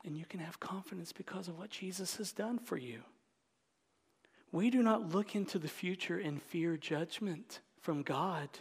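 ordinary talk

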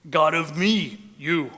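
blunt, loud "me" and "you"; caveman talk. Shown as a demonstration. Preacher likening the clunky Hebrew to caveman talk, blunt caveman phrasing. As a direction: punch out "God of me, you" in short chunks. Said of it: "kinda like caveman talk".

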